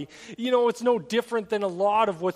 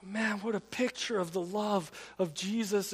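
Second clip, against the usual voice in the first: ordinary talk, nothing marked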